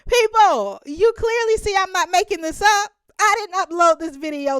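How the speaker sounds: high, loud appeal to the viewers; exasperated outcry. Real, not acted. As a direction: call out to the audience in disbelief. Ranting at viewers about video.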